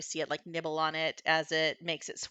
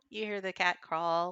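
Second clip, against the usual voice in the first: ordinary delivery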